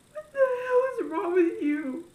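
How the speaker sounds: loud tearful outburst; angry. Retold or imitated. Yelling at God after her grandmother's death. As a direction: hurl it at God through tears. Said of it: "See, there's a cuss word".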